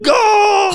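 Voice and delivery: very high, long mock-ecstatic wail; ecstatic scream. Re-enacting screaming in ecstasy with God, hammed-up wail. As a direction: cry it out high, half laughing.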